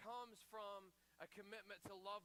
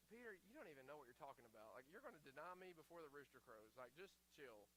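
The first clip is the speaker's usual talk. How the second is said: calm rebuke; 5 semitones lower, 5 dB quieter than usual. Retold or imitated. Jesus calming overconfident Peter.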